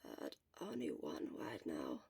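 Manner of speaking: hoarse sick whisper, barely any voice; Shota's voice. Acted. Shota whispering weakly to his friend from the sickbed. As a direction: whisper it like a sick man worn out.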